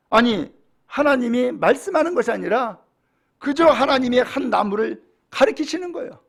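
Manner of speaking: usual speaking voice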